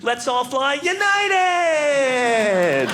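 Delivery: high, drawn-out announcer's cry; mock triumphant slogan shout. Mock airline announcer to passengers, triumphant slogan shout.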